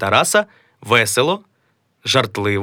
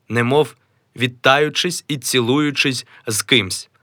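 ordinary talk, nothing marked

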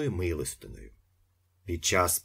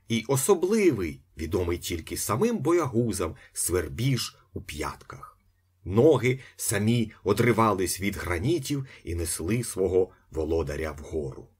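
plain reading voice

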